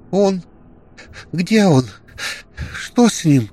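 high, loud sobbing cries; sobbing questions. A narrator's reading. Alena asks Kirill where Grek went, loud sobbing. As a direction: sob out each question. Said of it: "всхлипывала Алена".

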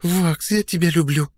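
put-on high, slow purring girl; blissful purr. A narrator's reading. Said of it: "блаженно промурлыкала Одри".

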